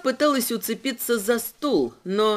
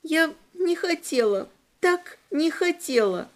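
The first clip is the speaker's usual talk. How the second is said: put-on sobbing child; 6 semitones higher than usual. A narrator's reading. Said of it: "тихонько всхлипнула".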